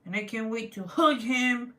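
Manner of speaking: mock-angry growl punching "hug"; stress on hug. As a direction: hit "hug" hard in a growly pretend rage. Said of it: "Emphasis on hug".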